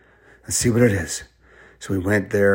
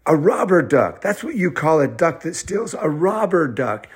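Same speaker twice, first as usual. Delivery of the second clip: put-on high, excited kid voice; pitched-up eureka moment; triumphant discovery; 6 semitones higher than usual. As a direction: announce the answer with rising glee. Children solve final riddle.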